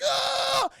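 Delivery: high, loud terrified yell; hammed-up cartoon shriek; mock scream. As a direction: scream as the bomb lands. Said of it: "Joker's like".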